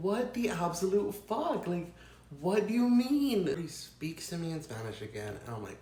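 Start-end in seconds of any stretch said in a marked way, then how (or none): 0.1-3.5 higher, slow, disbelieving what the fuck; incredulous outrage. Retold or imitated. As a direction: stretch it out in shocked disbelief. Retold outraged reaction.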